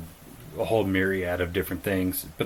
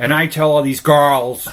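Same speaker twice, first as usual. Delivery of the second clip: loud, higher parody with mouth stretched wide; mocking Tate's speech; 6 semitones higher, 10 dB louder than usual. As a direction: overdo a gaping mispronounced swagger. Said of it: "randomly open his mouth really wide and mispronounce a word".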